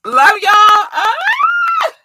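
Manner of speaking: high, loud squealed love; excited squeal. Real, not acted. Streamer thanking fans, squealing with joy. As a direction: squeal it happily.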